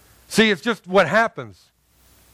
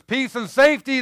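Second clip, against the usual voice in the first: raised, higher mocking call of peace; mock shout; 7 semitones higher than usual. Preacher mimicking people shouting peace. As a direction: call it out like a crowd chanting. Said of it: "they shout".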